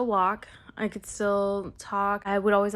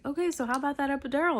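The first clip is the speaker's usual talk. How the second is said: higher, louder, fast bright doctor voice; cheery doctor; 7 semitones higher, 5 dB louder, much faster than usual. Retold or imitated. Doctor offering the epidural to a laboring mom, upbeat and quick. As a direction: rattle off "how about that epidural" like a chipper doctor.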